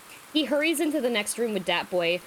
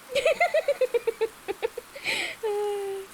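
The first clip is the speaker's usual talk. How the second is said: high, extended cackle; long high laugh; 14 semitones higher than usual. Real, not acted.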